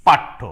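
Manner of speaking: পাঠ্য loud with doubled th; doubled th in পাঠ্য, said firmly; teaching example; pronounce paththo. Shown as a demonstration. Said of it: "ঠয় করে ঠো".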